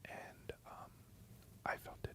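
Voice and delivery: barely audible whisper; faint, hushed ASMR question. Pretend. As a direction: whisper very softly into the mic. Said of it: "Can you do some A S M R".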